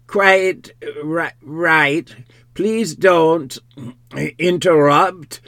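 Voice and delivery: loud, high, jerky halting answer; strained call, broken mid-word; jerking intonation. A narrator's reading. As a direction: jerk the words out with sudden breaks. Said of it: "answered with a curious jerking intonation".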